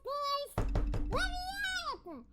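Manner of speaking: high panicked pleading; trapped pleading. Pretend. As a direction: beg to be let out, voice high. Trapped in washing machine, begging.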